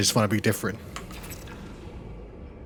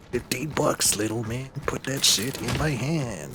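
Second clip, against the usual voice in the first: Mall Rats movie quote; 7 dB quieter, slower than usual. Retold or imitated. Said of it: "Mall rats".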